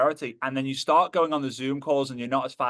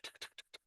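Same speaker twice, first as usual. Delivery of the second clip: hushed, voiceless clicking ticks; imitated roller coaster ticking; 25 dB quieter, much faster than usual. Imitating the roller coaster going up, voiceless ticks. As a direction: tick like a coaster climbing.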